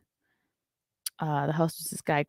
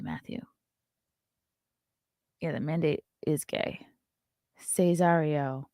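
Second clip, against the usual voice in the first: ordinary talk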